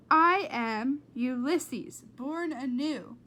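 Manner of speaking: slow, loud solemn announcing; grand proclamation. Acted. Flora reading the squirrel's typed words, grand. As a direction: declaim it like a hero's vow.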